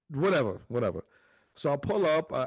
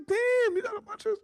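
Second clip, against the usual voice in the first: much higher, squealing impressed; mock impressed wife. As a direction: squeak it like a wowed mom. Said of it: "she's like".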